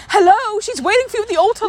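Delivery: high, fast mocking hello; mock exasperated hello. Friend mocking the groom, sharply exasperated. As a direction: snap him back to reality. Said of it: "And it's like".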